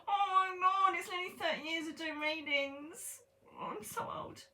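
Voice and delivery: very high-pitched hammed-up wail; squealing mock despair; mock horror. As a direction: wail in comic dismay, starting loud, trailing into a tiny squeak. Mock horror at realising her age.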